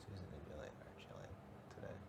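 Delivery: soft whispered repeat; hushed, barely voiced; whispering. Real, not acted. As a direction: whisper it over for one listener. Repeats it hushed after "Hmm?.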